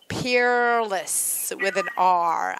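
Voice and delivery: drawn-out "Peerless" with the R stressed. Shown as a demonstration. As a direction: stretch "Peerless" slowly, sounding the R before the L. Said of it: "it actually has an R".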